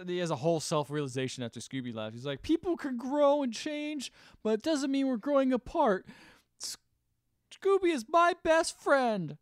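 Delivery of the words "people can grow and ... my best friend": high, loud, drawn-out mock lament; sad Shaggy. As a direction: imitate an emotional Shaggy, slow and pitched up. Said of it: "Shaggy starts being sad".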